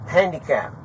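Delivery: ordinary delivery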